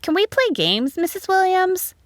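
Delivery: high-pitched eager pupil's question; high little schoolgirl voice; schoolchild asking. A narrator's reading. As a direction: ask the teacher in a high child's voice. Pupil asks teacher at recess.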